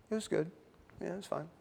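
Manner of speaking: high, small, unconvincing reassurance; evasive high voice. Acted. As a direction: pitch it way up, as if dodging the question. Evasive answer to wife, acted.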